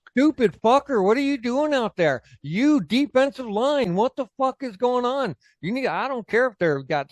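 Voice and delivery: high-pitched mock coach tirade; mean coach. Old coach to the defensive line, mean and berating. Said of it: "My coaches were fucking mean to you".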